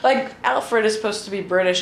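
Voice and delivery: ordinary talk, nothing marked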